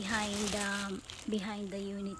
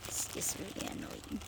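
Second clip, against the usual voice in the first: whispering; 9 dB quieter than usual. Real, not acted. Annoyed aside at turkey.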